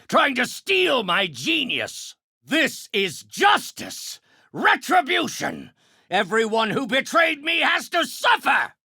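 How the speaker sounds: high, emphatic proclamations of revenge; vengeful ranting. Acted. Villain declares retribution on betrayers, high vengeful shouting. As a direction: declare it as righteous, raging judgment.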